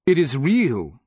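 loud, high, scornful retort; snorted. A narrator's reading. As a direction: throw it back with a snort. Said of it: "Porsperian snorted".

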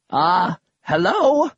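slow, drawn-out cheerful greeting; cheery old grandpa. Acted. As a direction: greet them like a dotty old man. Voices eccentric grandfather.

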